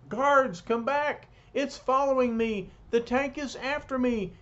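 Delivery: high, panicky cry for help. A narrator's reading. Khrushchev calls back his guards as the tank follows, panicking. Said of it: "Such panic from the mightiest man".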